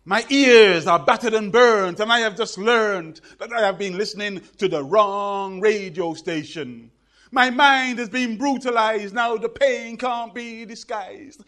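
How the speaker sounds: loud, high-pitched rhythmic poem recital; performance poetry. Acted. Performs his poem on stage.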